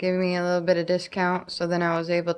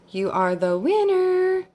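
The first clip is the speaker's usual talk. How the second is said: excited; 12 semitones higher, 4 dB louder than usual. Real, not acted. Announcing the winner.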